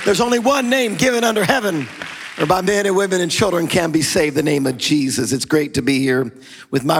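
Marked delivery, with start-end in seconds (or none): 0.1-5.3 high, ringing call to praise over music; full-force preaching. Acted. Pastor urging the church to praise Jesus, preaching at full force. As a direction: rouse the congregation to shout praise. Leads worship before the sermon.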